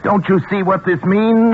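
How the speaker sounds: carried away by his discovery; feverish excitement. Acted. Scientist excited about his brain.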